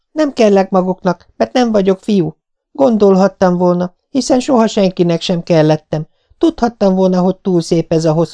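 put-on a little girl on the verge of tears; despairing young girl. A narrator's reading. Anne pleads to Marilla and Matthew, tearful.

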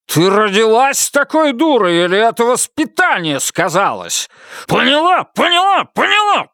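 the husband raging at his wife; building rage. A narrator's reading. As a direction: work up into a frenzy.

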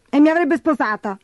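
high, put-on snivelling voice; self-pitying high-pitched moan; whimpering stepsister. A narrator's reading. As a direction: whine like a spoilt crybaby. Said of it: "piagnucolò Gertrude".

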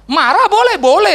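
high, loud burst on anger being allowed; loud preacher's emphasis; emphatic. Real, not acted. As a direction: belt out the permission to be angry.

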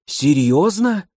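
plain reading voice

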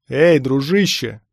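loud, drawn-out hail; calling out. A narrator's reading. Bystander hails the stormtrooper, calling out. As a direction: call across the crowd to him. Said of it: "окликнул я штурмовика".

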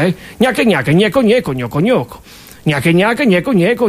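ordinary talk

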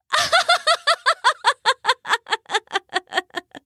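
long high giddy giggle; duck giggle. A narrator's reading. The duck giggles at Bailey, long and high. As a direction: cackle happily like a silly duck.

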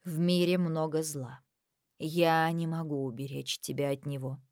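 slow, low and reluctant; forced, heavy words. Acted. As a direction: push each word out with effort. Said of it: "выговорила как будто через силу".

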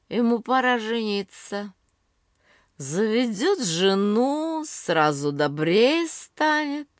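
put-on drunk slurring. A narrator's reading. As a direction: slur it like a tipsy aunt. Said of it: "таким же заплетающимся языком". (пьяная тётя, заплетающимся языком)

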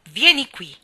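very loud sharp call; a ringing order across the grass; commanding shout. A narrator's reading. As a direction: summon Deacon at once. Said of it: "gridò a Deacon".

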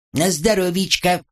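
high squeaky little servant voice. A narrator's reading. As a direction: squeak it out cheerfully from under the sink. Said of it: "пискнул мой слуга".

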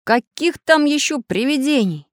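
high, slow incredulous question; surprised. A narrator's reading. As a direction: draw it out in disbelief. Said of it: "удивился Самоделкин".

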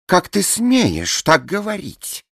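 high, loud, slow and haughty; proud. A narrator's reading. Blonde retorting proudly to Alice, high and slow. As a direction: draw yourself up proudly. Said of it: "гордо откликнулась Пергидрольная".

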